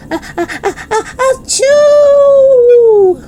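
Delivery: very high drawn-out ah-ah into loud achoo; violent sneeze. Acted. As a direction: build slowly, then explode. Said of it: "sneezed again even more violently".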